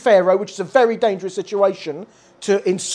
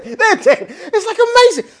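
excited; 9 semitones higher, 7 dB louder than usual. Real, not acted. Excited exclamation about dead Egyptians.

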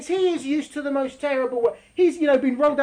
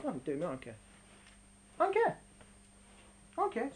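indifferent soul; 4 semitones lower, 11 dB quieter, much faster than usual. Acted.

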